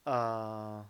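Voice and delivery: drawn-out low "uh"; "uh" sunk to a low pitch and held. Shown as a demonstration. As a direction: hold "uh" low in the voice. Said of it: "One is low, like".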